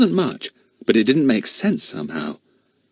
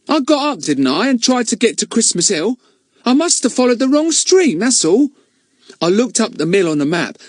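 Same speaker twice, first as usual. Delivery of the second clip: put-on high, louder aggrieved boy; Ern voice; 11 semitones higher, 4 dB louder than usual. Ern insists he saw a light, high and aggrieved. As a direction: defend yourself like a wronged lad.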